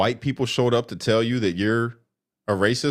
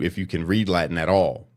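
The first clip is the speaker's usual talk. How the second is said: ordinary delivery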